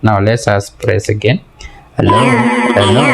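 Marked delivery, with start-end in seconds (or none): 2.0-3.0 hello through a voice-changer setting; processed test hello; changed mic voice. Real, not acted. Reviewer cycling voice modes, changed mic voice. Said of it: "my voice is still changed".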